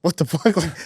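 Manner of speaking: high, laughing disbelief; hearing a joke misread, laughing disbelief; mock disbelief. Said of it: "And you say".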